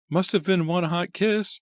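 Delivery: high, loud teasing quip; high playful cheerleader jibe; joking cheerleader. A narrator's reading. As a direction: toss off a joke as a cheerleader. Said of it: "one of the cheerleaders joked".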